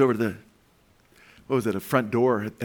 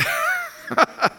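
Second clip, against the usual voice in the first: a last high burst of laughter; high laughing tail; 3 dB louder than usual. Real, not acted. Grandpa finishing his laugh at the story. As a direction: let the laugh fall away.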